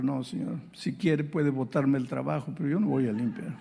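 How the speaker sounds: ordinary talk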